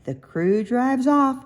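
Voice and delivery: ordinary delivery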